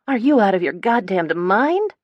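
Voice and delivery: screech. A narrator's reading. Gemma appalled at Shelby's plan, outraged screech. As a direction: screech it at her in disbelief. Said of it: "Gemma screeches".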